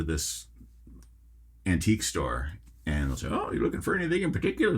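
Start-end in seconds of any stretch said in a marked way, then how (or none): 3.3-4.8 high, fast, quavery shopkeeper voice; old person voice. Retold or imitated. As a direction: squeak it out like an elderly clerk. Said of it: "That's my old person voice".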